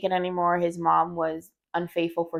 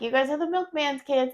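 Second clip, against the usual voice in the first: mock sibling taunt, pitched up; teasing siblings; 7 semitones higher than usual. Older sibling picking on the younger two, teasing. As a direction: playfully imitate kids picking on siblings. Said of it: "would pick on them".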